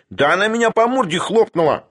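high indignant squeal; squealing, aggrieved character voice. A narrator's reading. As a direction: shoot up high in outraged protest. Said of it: "взвизгнул Шариков".

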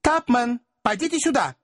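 high, curt summons; short cried command, pitched high; abrupt call. A narrator's reading. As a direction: call out sharply for a companion. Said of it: "отрывисто крикнул".

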